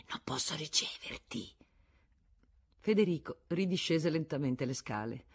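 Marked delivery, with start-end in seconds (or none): 0.1-1.5 soft unvoiced whisper; whispered refusal. A narrator's reading. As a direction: breathe it so nobody else hears. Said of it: "un ampio gesto che voleva dire". (sussurrato, un rifiuto segreto)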